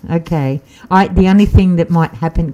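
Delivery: ordinary talk, nothing marked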